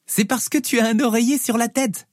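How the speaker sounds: put-on high child's voice, lightly amused; amused little girl. Daughter teasing dad under his pillow, amused child voice. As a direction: tease in a little girl's high voice. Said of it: "rit-elle".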